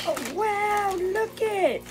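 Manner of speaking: high, excited oh wow; high-pitched delighted exclamation; thrilled squeal. Real, not acted. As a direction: gush at the toy in a high voice. Unboxing toy, thrilled.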